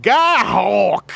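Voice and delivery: loud, high Texan holler of the name; Texan yell. Retold or imitated. Voice actor recalling his Texan character's cry, loud Texan holler. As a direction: bellow it like a cowboy. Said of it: "I'd be like".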